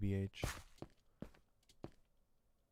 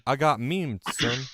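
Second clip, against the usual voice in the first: put-on southern accent; 4 semitones higher, 9 dB louder, slower than usual. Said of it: "Fucking southern ass accent".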